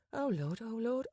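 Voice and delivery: flustered gasp. Acted. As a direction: fluster as she starts to panic. Penny's flustered inner panic.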